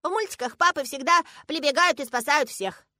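put-on high, loud little-girl voice; absolutely confident child. A narrator's reading. Girl explaining cartoons to her father, utterly certain. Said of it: "заявляет она с абсолютной уверенностью".

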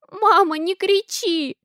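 put-on high, slow tearful little girl; a small child begging through tears; crying child. A narrator's reading. Daughter begs mom not to shout, crying. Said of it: "плачет Алиса".

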